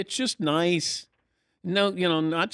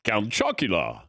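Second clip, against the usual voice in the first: low, mock vampire voice; Dracula parody; 5 semitones lower than usual. Host joining the vampire joke, low Dracula voice. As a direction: answer like a cartoon Count Dracula.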